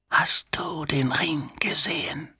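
wheezing, menacing whisper; strange wheezing voice. A narrator's reading. Stranger at Two-Tone's ear demanding an answer, wheezing. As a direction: wheeze it out softly, almost without voice. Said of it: "mit merkwürdig keuchender Stimme".

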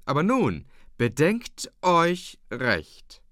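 loud, high, very slow urging; calling out. A narrator's reading. Ole Hensen urging the room to reflect, calling out. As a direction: press each word on them, drawn out. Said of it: "rief Ole Hensen wieder".

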